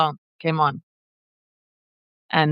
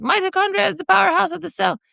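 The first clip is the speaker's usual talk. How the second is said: much higher, fast squeaky quote; 11 semitones higher, faster than usual. Retold or imitated. Remembering her doodle, a high quick squeal. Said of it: "And then I was like".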